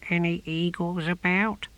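high, small creature voice; tortoise inside shell. A narrator's reading. Tortoise asking the novice about eagles, high and small. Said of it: "spoke from deep within its shell".

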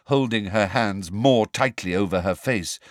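ordinary delivery, nothing marked

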